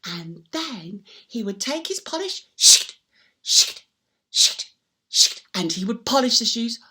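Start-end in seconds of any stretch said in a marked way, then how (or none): 2.6-5.4 sharp loud shh-k swishes; polishing swish. Acted. Storyteller to children, loud polishing swishes. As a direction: rub the polish on with each burst.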